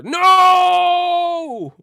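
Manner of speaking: long, very high, very loud drawn-out no. Retold or imitated. Mocking haters with a long screamed no. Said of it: "when you say Mayweather Canelo".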